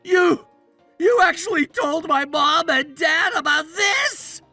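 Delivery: shouted, stammering question; stammer, then cry out in alarm; aghast disbelief. Acted. Husband learning his wife told his parents, aghast.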